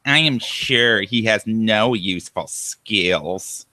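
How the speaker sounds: slow, whiny sneering read. Acted. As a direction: whine it out like a sulking troll. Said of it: "read all of his comments with the whiny voice".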